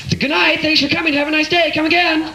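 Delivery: very high sing-song cashier voice; chirpy employee voice. Retold or imitated. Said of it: "they all lean over".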